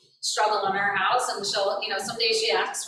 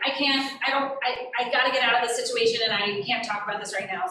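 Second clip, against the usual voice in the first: fast, overwhelmed rush of words; faster than usual. Retold or imitated. Boy escaping a tense situation, rushed and overwhelmed. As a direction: blurt it out wanting to escape. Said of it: "he is more of the, like".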